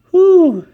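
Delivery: sudden high, loud whooping hoot. Acted. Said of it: "a loud noise came".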